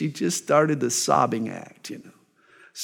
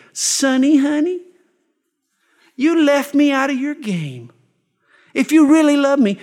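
higher, coaxing mock-sweet plea to her husband; wheedling wife; 8 semitones higher, 4 dB louder than usual. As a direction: lay on the sweetness to get the secret out of him. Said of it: "she makes him feel guilty".

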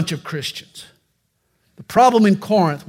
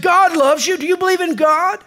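high, eager voice, much higher; eager rescuer; 10 semitones higher than usual. Acted. Rescuer on the bridge eagerly quizzes the jumper, high and keen. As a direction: pitch it up, bright and keen.

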